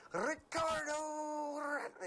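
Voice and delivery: high, loud PA announcer call, name stretched long; drawn-out arena call. Shown as a demonstration. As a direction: drag out RICARDO in a booming player intro. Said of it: "I really drug. It was like".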